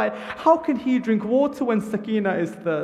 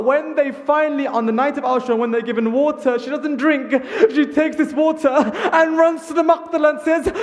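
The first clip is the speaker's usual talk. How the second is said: higher, louder sermon delivery; impassioned preaching; 6 semitones higher, 4 dB louder than usual. Acted. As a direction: drive the story on with fervour. Majlis preaching at full force.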